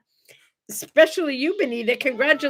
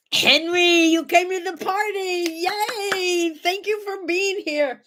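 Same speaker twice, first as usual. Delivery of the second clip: excited greeting; 7 semitones higher, slower than usual. Real, not acted. Greeting guest at party.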